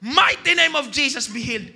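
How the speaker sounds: loud, declaiming prayer for healing; hammed-up preacher commanding healing; mock shouted healing prayer. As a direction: belt out a showy healing command.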